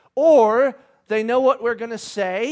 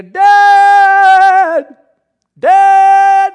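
long, high, very loud holler; insistent mock child's yell; child yelling for Dad; 10 semitones higher, 16 dB louder than usual. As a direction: bellow it like a kid calling from the bathroom. Small son calling at night.

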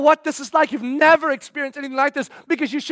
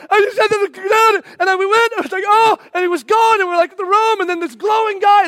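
high, loud tumbling excited babble; women bursting in with the news, excited babble; breathless excited rush; 8 semitones higher, 5 dB louder than usual. Pretend. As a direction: blurt it all out at once, thrilled.

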